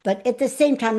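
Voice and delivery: ordinary talk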